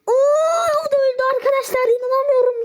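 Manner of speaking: gasping at the kill, fast; excited disbelief. Real, not acted. Enemy killed, disbelief. (heyecanlı, hızlı inanamama)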